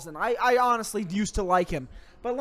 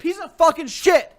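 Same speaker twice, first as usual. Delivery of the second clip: angry; 7 semitones higher, 6 dB louder than usual. Acted. Echoing the rant.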